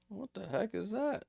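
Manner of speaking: baffled by a strange food; incredulous. Real, not acted. Reading about sausage sizzles.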